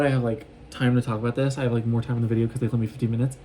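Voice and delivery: rapid, rushed aside; hurried explanation squeezed in fast; talking fast. Real, not acted. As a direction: rattle it off quickly. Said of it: "Sorry if I talk fast".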